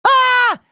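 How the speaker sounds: high-pitched cartoon yelp; high startled scream. Hero startled by flying enemies, high scream.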